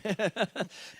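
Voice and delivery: a quick laugh after your own joke; chuckle. Real, not acted. Joking about joining someone in vacuuming, chuckling.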